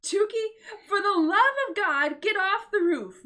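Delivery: high, loud furious screech; mother screeching at Tukey. Acted. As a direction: screech at her. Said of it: "Missus De La Creme screeched".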